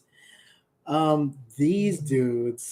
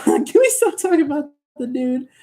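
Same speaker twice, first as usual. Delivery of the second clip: high, strained complaint; comic exasperation; 11 semitones higher, 5 dB louder than usual. Pretend. Streamer begging viewers to drop the subject, exasperated. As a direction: whine it high in mock despair.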